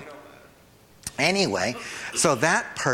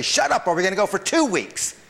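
defiant retort; 7 semitones higher than usual. Acted. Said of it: "I say".

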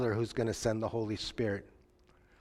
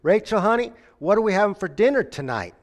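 higher and louder, calling across the house; hollering; 7 semitones higher, 7 dB louder than usual. Retold or imitated. Husband calling from the bedroom to his wife, hollering high and loud. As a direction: holler it to a wife in another room. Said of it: "he hollers out there".

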